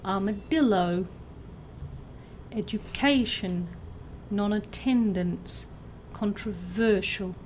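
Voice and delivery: "armadillo", "education", "non-attendance", "controversial", each stressed on its third syllable. Shown as a demonstration. Teacher reads out answers, third-syllable stress from "armadillo" to "controversial". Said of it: "or the third syllable".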